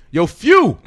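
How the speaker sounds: loud high shout across a room; yelling across the room. Retold or imitated. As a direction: call out loud and high to a friend. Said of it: "he yells across the room".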